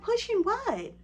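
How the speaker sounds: very high, loud "pushing what"; high-pitched disbelief; baffled receptionist. Retold or imitated. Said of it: "She said".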